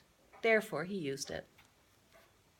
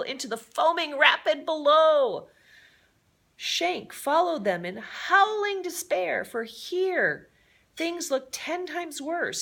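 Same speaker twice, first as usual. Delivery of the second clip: climbing higher and louder as the river sweeps them away; breathless excitement; 9 semitones higher, 6 dB louder than usual. A narrator's reading. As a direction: race through the danger, pitch rising with every blow. Narrating a drowning rescue.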